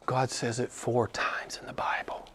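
soft, dramatic preacher's whisper; hushed revelation. Real, not acted. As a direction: whisper it as a weighty disclosure.

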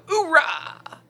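high gung-ho soldier shout; Al Pacino impression. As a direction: bark it like a blind colonel. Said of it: "Al Pacino".

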